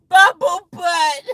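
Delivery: high, loud, slow mocking voice; obnoxious jeer. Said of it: "she laughed it obnoxiously".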